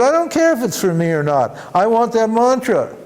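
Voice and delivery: insistent demand. Retold or imitated. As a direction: demand it, won't take no. Disciple insists on the mantra.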